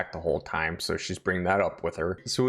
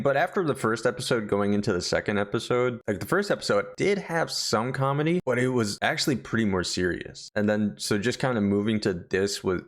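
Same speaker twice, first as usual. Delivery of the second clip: normal talking voice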